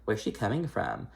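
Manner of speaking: softly muttered aside, a touch of mock snark; under her breath. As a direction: mutter it so only the next person hears. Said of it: "says under her breath".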